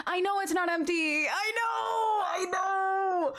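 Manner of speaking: high, drawn-out mock wail; whiny, put-on cry of protest. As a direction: wail it in playful exasperation. Mock protest to viewers at lateness.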